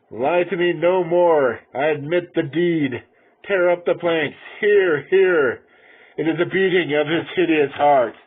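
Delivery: high, loud and slowed; frantic confession at full force; screamed confession. Acted. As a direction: scream the guilt out, unhinged.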